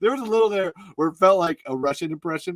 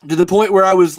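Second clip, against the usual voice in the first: normal talking voice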